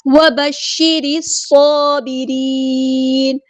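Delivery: slow, drawn-out melodic tajweed recitation; Quran recitation. Shown as a demonstration. Teacher recites the verse for students, slow melodic chant. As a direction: chant each word slowly in tajweed.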